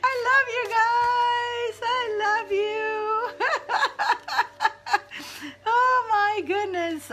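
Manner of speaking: high, slow, tearful laughing; laughing through tears. Real, not acted. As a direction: let the love spill over in laughter. Overcome with emotion on stream.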